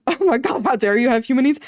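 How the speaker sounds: mock offended retelling of the nurse; mock outrage. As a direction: play it as a hammed-up scandalised gasp. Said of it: "That's exactly what she was like".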